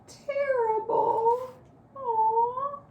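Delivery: high, crying sorrow; sad cry. A narrator's reading. Narrating Guji Guji's sorrow in a high crying voice. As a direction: let the voice break with sadness.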